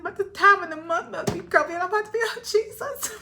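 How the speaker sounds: a light hammed-up oh Jesus; mock exasperation. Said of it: "I said".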